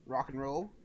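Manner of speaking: ordinary talk, nothing marked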